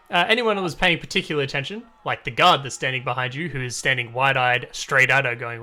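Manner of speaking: ordinary talk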